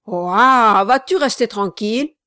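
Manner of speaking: loud, angry yell at the pony; shouting insults. Acted. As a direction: bark at the restless pony. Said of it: "si vous lui lancez des injures".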